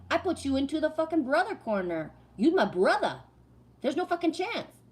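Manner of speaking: retold exasperated put-down; emphatic rebuff. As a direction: lay down the law, pitch jumping up. Retold argument, repeating herself emphatically.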